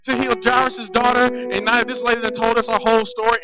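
high, loud comic complaint; hammed-up protest at being held up; mock exasperated outburst. Disciple held up on the way to Jairus' daughter, exasperated.

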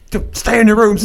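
loud, high-pitched mock panic; acted movie quote, shouted. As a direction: yell it out like a movie line. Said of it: "the part where he goes".

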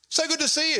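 high-pitched delighted call to a friend; effusive greeting. Acted. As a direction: hail a friend at church. Acted greeting at church.

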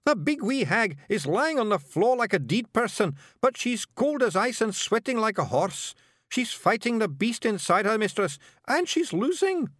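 high, urgent Feegle plea; frantic little Scots warrior begging for help; Scottish yell. A narrator's reading. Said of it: "Rob Anybody yelled".

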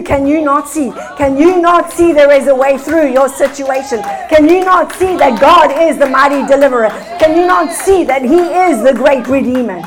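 preaching at full force, ringing out; fiery preaching. Acted. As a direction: build the congregation up with fervor. Preaching crescendo.

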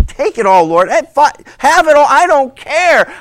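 loud, high cry of surrender; exuberant surrender. Acted. Believer handing life over to the Lord, exuberant. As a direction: throw it all to God, loud and carefree.

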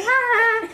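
very high, loud mock child's laugh; imitated kid's laugh. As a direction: cackle like an oblivious little kid. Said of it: "And the kid's like".

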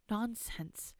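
put-on grandmother gently hushing a child; soft dismissal. Grandmother soothes sobbing child.

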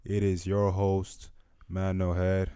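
loud, hyped podcast host intro; announcer. Acted. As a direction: pump up the crowd like an announcer.

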